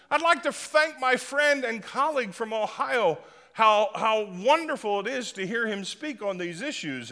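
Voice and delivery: high, sugary mock-polite senator; butter him up in a high fawning voice. Preacher mocking a senator praising his rival, high and sugary.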